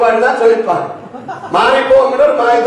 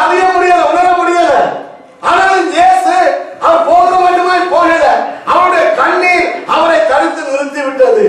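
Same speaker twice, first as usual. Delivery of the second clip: high, full-force sermon delivery; ringing preacher's cry; fervent preaching; 7 semitones higher than usual. Acted. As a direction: proclaim it urgently to the congregation.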